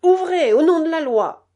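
loud, high command in the name of the law; officer pounding at the door at night; loud stern order; authoritative shout. A narrator's reading. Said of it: "dit une voix derrière la porte".

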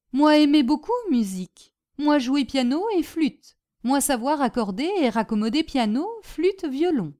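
lightly voiced foreigner in broken French; Boginski, cheerful Polish storyteller. Polish guest telling his hostess of boots and battle, cheerful. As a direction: tell your war tale eagerly, phrase by phrase.

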